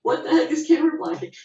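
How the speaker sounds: high mock panic of a first-day actor; retold panic. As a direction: sound lost and out of your depth. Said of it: "I was just like".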